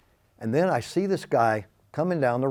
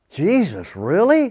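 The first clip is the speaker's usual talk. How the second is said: surprised; 6 semitones higher, 5 dB louder, slower than usual. Retold or imitated. Astonished at the answer.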